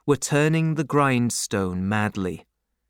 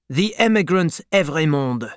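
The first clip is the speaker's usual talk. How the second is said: higher-pitched curt announcement; announcing a prisoner; 4 semitones higher than usual. A narrator's reading. Defarge hands a prisoner to the jailer, announcing.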